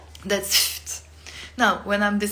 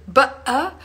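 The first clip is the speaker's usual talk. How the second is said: "Ba'a" popped out loud and high; playful scare; 5 semitones higher, 7 dB louder than usual. Shown as a demonstration. Adult scaring a child through a door, high and loud. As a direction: jump out at a little child with "Ba'a". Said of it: "So you can say".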